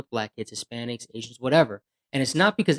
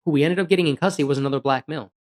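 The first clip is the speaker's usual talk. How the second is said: ordinary talk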